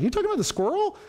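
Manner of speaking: retold disbelief, pitch jumping up; incredulous question. Speaker checks what the upset man means, incredulous. Acting out his own baffled reply.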